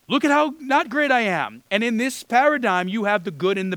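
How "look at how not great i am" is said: higher and louder, playfully self-deprecating; mock humble tax collector. Preacher imitating the tax collector at the temple, mock humble and high. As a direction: sell your own lowliness with a grin. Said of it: "the tax collector who's like".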